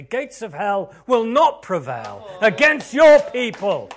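fiery pulpit proclamation; preaching at full force. Acted. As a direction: thunder it against the enemy.

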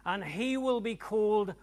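high, loud proclamation building to a shout; rousing full-force preacher delivery. Acted. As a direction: thunder out the scripture, drawing out key words.

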